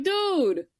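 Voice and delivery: high, exasperated retold outburst; indignant. Telling off a customer who spoiled the show, indignant. Retelling an angry confrontation over spoiler.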